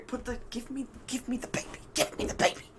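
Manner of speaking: fast, soft whisper off the phone; whispered aside. Acted. Caspian whispering to Ethan off the call, soft fast whisper. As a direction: hush it away from the call. Caspian quietly grabbing the baby.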